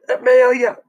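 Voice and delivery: loud high call across the house. Acted. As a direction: call her name sharply from the bed. Calls again for the girl.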